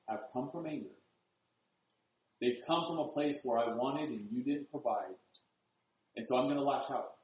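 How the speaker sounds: low, hushed, sober reflection; soft, solemn pulpit voice with long pauses; grave preaching. Real, not acted. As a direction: bring the congregation down to a quiet, regretful reckoning.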